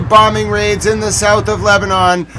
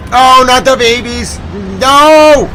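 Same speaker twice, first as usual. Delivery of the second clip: loud, high "No!"; anguished shout; 3 semitones higher, 4 dB louder than usual. Real, not acted. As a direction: yell it out in anguish. Reacting to babies hit.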